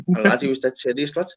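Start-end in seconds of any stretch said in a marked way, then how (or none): none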